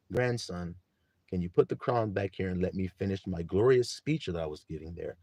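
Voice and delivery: low, softened elder voice; patient grandfather. Acted. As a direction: quietly chide a grandson, low and soft. Plays Abba addressing grandson.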